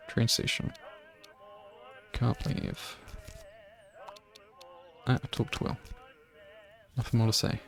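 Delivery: reads it under her breath, unvoiced; whispering. Real, not acted. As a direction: whisper the options to herself. Reading options under her breath.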